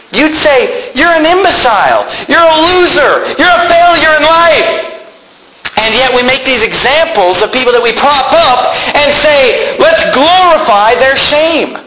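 high, ringing pulpit shout; full-force preaching. Real, not acted. Preacher condemns Hollywood's shame to his congregation, shouting. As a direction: drive each phrase out in sustained indignation.

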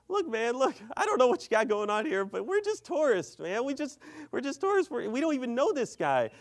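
high-pitched anxious plea; nervous pleading. Retold or imitated. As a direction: plead your innocence in an acted high voice. Acting out friend's timid second plea.

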